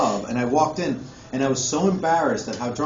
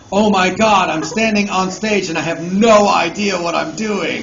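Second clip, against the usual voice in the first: loud, higher-pitched mock panic; acted panic; 4 semitones higher, 7 dB louder than usual. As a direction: blurt out your stage panic for laughs. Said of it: "I'm actually starting to panic".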